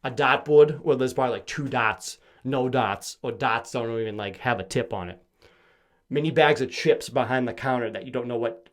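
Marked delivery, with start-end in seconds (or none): none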